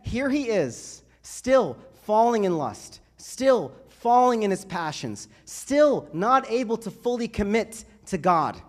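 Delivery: loud, high, rising sermon build; full-force homily crescendo; impassioned preaching. Acted. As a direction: preach it with growing fire.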